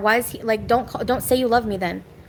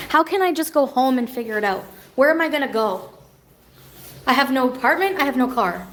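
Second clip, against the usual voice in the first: ordinary talk, nothing marked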